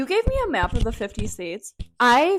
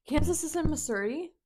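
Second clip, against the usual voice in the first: gasping, higher astonished question; shocked gasp; 5 semitones higher, 5 dB quieter than usual. Real, not acted. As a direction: gasp in disbelief. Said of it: "You just sounded like you spoke Sims".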